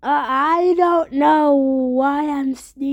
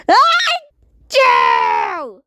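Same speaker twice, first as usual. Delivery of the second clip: big sneeze; 6 semitones higher than usual. Acted. Character sneezes after denying sickness.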